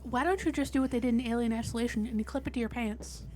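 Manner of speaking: ordinary talk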